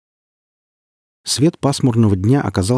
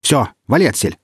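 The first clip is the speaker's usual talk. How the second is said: higher, restrained barked order; understated gruff command, pitched up; mild bark; 5 semitones higher than usual. A narrator's reading. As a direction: send him off with a light bark. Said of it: "гаркнул наёмник".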